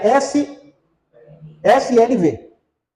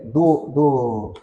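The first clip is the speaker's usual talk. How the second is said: ordinary talk, nothing marked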